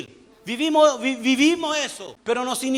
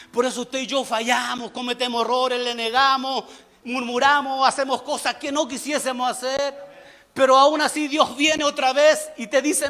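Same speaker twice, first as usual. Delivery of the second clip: ordinary talk, nothing marked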